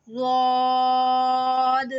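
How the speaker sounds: रौद held long and thick; heavy long zaad. Shown as a demonstration. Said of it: "और ये भी मोटा अदा होगा". (रौद मोटा और लंबा)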